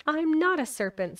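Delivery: put-on offended girl setting the record straight; a child's indignant denial. A narrator's reading. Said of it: "said Alice indignantly".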